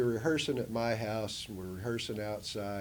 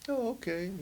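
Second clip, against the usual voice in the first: easy agreement; 12 semitones higher than usual. Retold or imitated.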